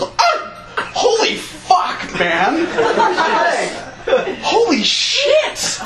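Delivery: high, slow horrified cries; medic freaking out. Retold or imitated. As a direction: panic at the sight of the leg. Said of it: "looks at me and goes".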